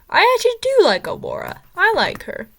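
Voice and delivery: bright, high burst of liking; excited. Real, not acted. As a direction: gush about her with real delight. Excited praise of Aurora.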